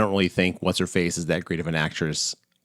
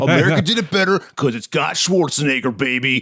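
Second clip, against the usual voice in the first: mock brash American; 6 semitones higher than usual. Mocking the American answer with a brash patriot voice. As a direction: play a dumb proud American for laughs. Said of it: "that's such an American response".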